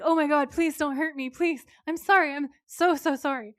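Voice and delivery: high, loud hammed-up begging; panicked pleading. Captive Gary begs Dean not to hurt him, panicked pleading. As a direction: beg for mercy in mock terror.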